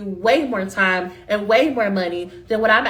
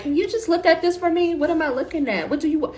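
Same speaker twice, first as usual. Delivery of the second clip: higher-pitched, incredulous mock complaint; exasperated chair; 6 semitones higher than usual. Chair reacting to a sloppy student draft, mock exasperation. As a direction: play the fed-up professor skimming a messy draft.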